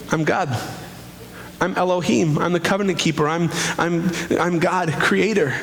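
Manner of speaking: ordinary delivery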